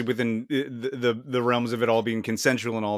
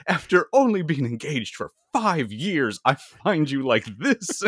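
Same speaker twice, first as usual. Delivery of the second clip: outraged doctor; 4 semitones higher, 3 dB louder than usual. Retold or imitated. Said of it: "the doctor's like".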